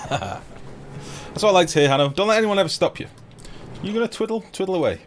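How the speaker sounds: high, loud mock-rousing encouragement; rally him on like a coach; hyped cheering. Real, not acted. Streamer cheering on a chat viewer, high and loud.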